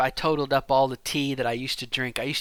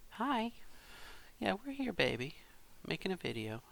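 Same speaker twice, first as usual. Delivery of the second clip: high, soft voice for a little one; 8 semitones higher, 8 dB quieter than usual. Real, not acted. Parent checking on their son, tender and high. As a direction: coo it gently, pitched well up.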